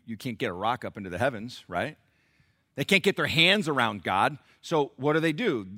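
ordinary talk, nothing marked

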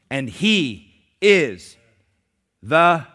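slow, loud, drawn-out reading; slow scripture proclamation. Acted. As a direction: lift every word of the verse.

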